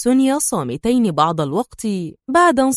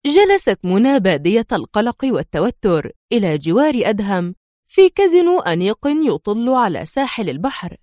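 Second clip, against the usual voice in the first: usual speaking voice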